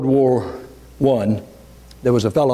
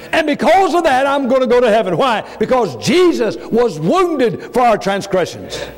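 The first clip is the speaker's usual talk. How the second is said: full-force preaching; 9 semitones higher, slower than usual. Acted. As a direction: preach it hard to the congregation.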